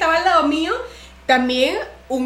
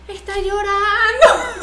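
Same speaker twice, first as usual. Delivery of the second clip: high, loud, drawn-out mock pity; stretched-out pitying coo, close to cracking up; cooing sympathy, mocked; 8 semitones higher, 5 dB louder, much slower than usual. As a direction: imitate pitying churchgoers through your own laughter. Old churchgoers mistake laughter for weeping.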